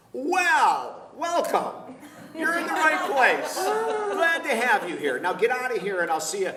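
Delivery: loud, high-pitched booming-out of a mock welcome; hammed-up welcome. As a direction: greet the newcomer with big, drawn-out enthusiasm. Priest's reply to sober answer.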